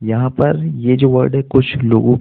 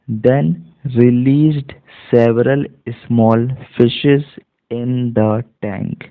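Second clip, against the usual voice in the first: slow reading demo; much slower than usual. Shown as a demonstration. Teacher reading slowly word by word to students. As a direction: read each word out slowly, one by one.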